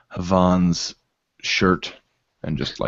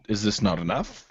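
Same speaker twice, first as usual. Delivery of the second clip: implied question; 5 dB quieter than usual. Acted. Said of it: "As if to say".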